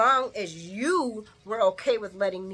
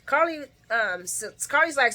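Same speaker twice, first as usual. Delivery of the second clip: normal talking voice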